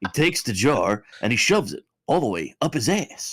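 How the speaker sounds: gruff action hero laughing through; Duke Nukem-like voice. Retold or imitated. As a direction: growl it like a cocky action hero. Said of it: "You just sound like".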